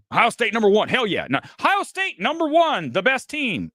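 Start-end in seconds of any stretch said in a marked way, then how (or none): 1.6-3.7 high, hammered-out hype delivery; oversold line. Shown as a demonstration. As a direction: sell it hard, the opposite of casual. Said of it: "That's not throwing it away".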